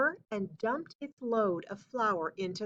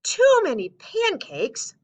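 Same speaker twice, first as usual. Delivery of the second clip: loud, high disbelief; shocked at the very idea; exclaiming; 10 semitones higher, 8 dB louder than usual. Acted. Paul answering the doctor, loud high disbelief. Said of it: "Paul exclaimed".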